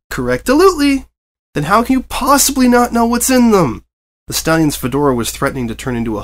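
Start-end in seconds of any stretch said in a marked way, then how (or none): 0.1-1.1 high, bouncy chatterbox pony voice; breathless cheerful pony chatter; bubbly Pinkie Pie. A narrator's reading. Pinkie answers brightly. 1.5-3.8 high, loud exasperated reporter; incredulous fed-up reporter. A narrator's reading. Reporter losing patience.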